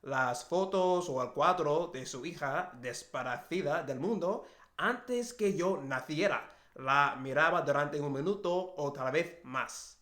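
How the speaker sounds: reading aloud in a Spanish accent, Castilian flavour; practising a Spanish accent while reading a book aloud. Shown as a demonstration. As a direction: read the Spanish lines with a careful Spanish accent. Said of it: "my book a lot with the accent".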